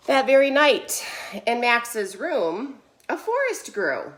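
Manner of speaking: emphatic slow storytelling with long pauses; expressive, drawn-out read-aloud; picture-book narration. A narrator's reading. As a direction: read it like a storyteller, swelling on each phrase. Reads picture book to children.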